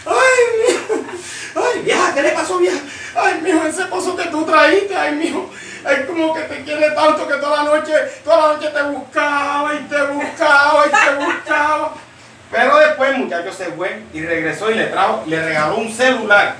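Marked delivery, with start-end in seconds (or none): 0.1-11.9 put-on high-pitched wailing old woman, laughing through it; wailing old mother. Old mother wailing to her son, high crying voice.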